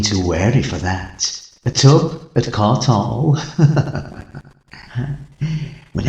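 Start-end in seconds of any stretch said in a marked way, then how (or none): 1.7-3.4 slow gloat. Acted. Gleeful secret of no blood. 3.4-4.4 sly whispered chuckle. Acted. Sly laugh after the tub.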